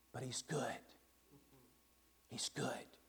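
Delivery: hushed, breathy awe; soft whispered reassurance about the lion; reverent whisper. A narrator's reading. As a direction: whisper it like a sacred secret. Beaver reassures about Aslan.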